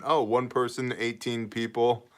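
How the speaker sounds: usual speaking voice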